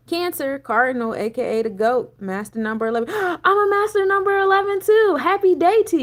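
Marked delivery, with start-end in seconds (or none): 3.4-6.0 gasp, then much higher and louder; delighted to find a match; excited gasp. Real, not acted. To the viewer with the same number, a thrilled gasp. Finds she shares the number.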